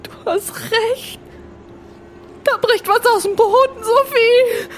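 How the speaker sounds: acted fear. Watching the ground break open from the balcony, rising acted fear. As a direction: rise in pitch as the terror builds.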